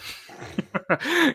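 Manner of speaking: rising high laugh. Real, not acted. Laughing at the joke.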